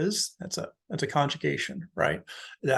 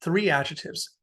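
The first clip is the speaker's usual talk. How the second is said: normal talking voice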